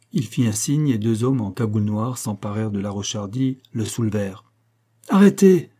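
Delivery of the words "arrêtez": un cri aigu et fort pour arrêter. Acted. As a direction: crie Arrêtez de toute ta voix. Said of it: "cria Simonet". (Simonet crie pour arrêter les bandits)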